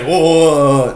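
loud, high string of whoas; startled protest. Retold or imitated. Intruder caught in the bedroom, alarmed whoas. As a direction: imitate a caught guy backing off.

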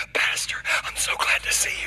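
excited stage whisper, imitating the agent; imitated gushing whisper; whispered greeting. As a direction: whisper it like a thrilled secret fan. Agent privately greets her pastor.

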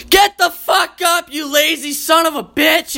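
high, shouted robotic wake-up yell; angry alarm clock. Retold or imitated. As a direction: bark it like a furious alarm clock. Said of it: "your alarm clock's like".

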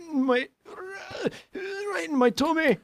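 high squeaky voice whining about a poke in the tummy; whiny hurt character. Pretend. Cartoon sailor whining to his friend about being poked.

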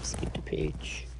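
very soft, slow whisper to oneself; real off-hand whisper, barely voiced; whispered aside. Real, not acted. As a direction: whisper it under your breath. Talking to himself while turning notes.

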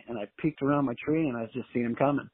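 ordinary talk

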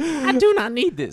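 high, loud sitcom line delivery; TV show quote. Retold or imitated. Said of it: "If you ever watch Everybody Hates Chris".

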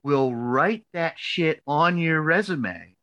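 mock-stern, drawn out and rising in force; emphatic slow insisting. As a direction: imitate an exasperated mentor driving it home. Retold advisor insisting, building.

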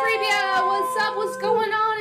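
high, loud mock-delighted hail; excited greeting. Droid reunited with his partner, acting excited.

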